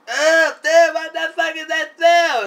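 very high, loud yell of disgust; disgusted shout. Retold or imitated. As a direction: shriek at the awful smell. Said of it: "everybody was like".

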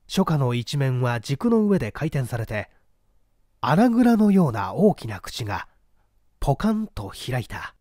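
plain reading voice

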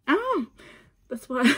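normal talking voice

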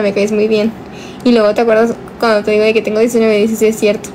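ordinary talk, nothing marked

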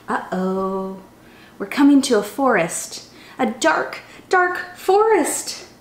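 lively, sing-song storybook reading; animated children's story voice. A narrator's reading. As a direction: read it like a chant for little kids. Reads a picture book aloud.

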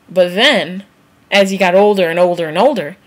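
ordinary talk

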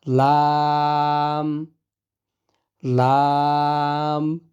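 slow, drawn-out লাম; Arabic lam. Shown as a demonstration. Teacher reciting lam to students, slow drawl. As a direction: stretch the লাম long. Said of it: "teacher reciting letters".

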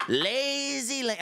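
high, drawn-out mocking heckle; Donald Trump. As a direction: stretch it into a slow taunting nickname chant. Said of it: "I start Trump heckling him".

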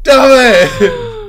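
frustrated shout. Real, not acted. Caught in game.

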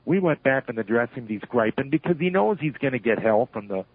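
ordinary talk, nothing marked

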